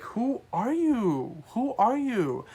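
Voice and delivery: who do you think you are; incredulous. Real, not acted. Waitress exasperated by rude customer.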